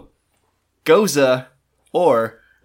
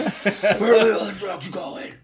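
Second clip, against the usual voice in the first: Bat voice; 8 semitones higher than usual. Retold or imitated. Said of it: "my Bat voice".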